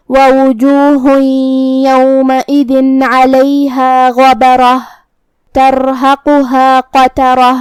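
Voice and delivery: slow, full-voiced tajweed chant; Quran recitation. Shown as a demonstration. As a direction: let the verse ring out in measured tajweed.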